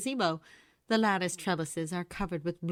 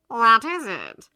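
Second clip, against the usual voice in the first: suspicious; 4 semitones higher, 12 dB louder, slower than usual. A narrator's reading. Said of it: "He sounds suspicious".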